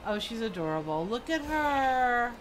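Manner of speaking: ordinary talk, nothing marked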